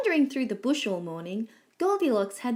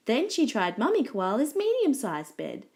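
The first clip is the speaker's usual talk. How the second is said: ordinary delivery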